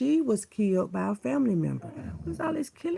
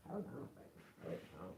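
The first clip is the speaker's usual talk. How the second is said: whisper; 20 dB quieter than usual. Real, not acted. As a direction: hush them gently under your breath. Hushing to someone nearby.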